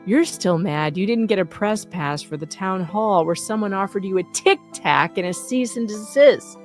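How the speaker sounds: normal talking voice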